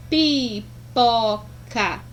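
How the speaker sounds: "pipoca" very slow, in three separate syllables; each syllable of "pipoca" drawn out with gaps. Shown as a demonstration. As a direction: pull "pipoca" apart, pi, po, ca. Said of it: "abri a boca três vezes para pronunciar".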